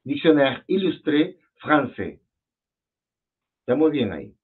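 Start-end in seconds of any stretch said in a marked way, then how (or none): 0.0-2.2 "Dictionnaire Illustré Français" slowly, word by word in French; slow model of "Dictionnaire Illustré Français", silent final S; French pronunciation. Shown as a demonstration. Teacher confirms the student's attempt, slow French pronunciation. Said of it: "¿Cómo creías tú que se pronunciaba?".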